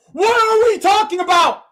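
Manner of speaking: high, loud angry yelling; furious shout. Real, not acted. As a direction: bellow the question in disbelief. Repeated raging at the game.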